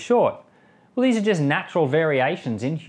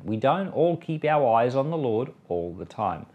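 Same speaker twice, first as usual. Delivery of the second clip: ordinary talk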